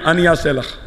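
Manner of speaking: usual speaking voice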